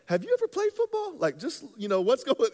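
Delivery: mock the coach with a high, scornful question; incredulous scoff. Young player scoffing at his coach, high and incredulous. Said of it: "he was one of those coaches that I was like".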